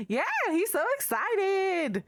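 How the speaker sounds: high, gushing coo about the little guy; gushing over pet. Real, not acted. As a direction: squeal it like a proud pet owner.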